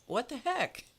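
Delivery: a baffled cat, voiced high; puzzled cat. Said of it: "who again goes".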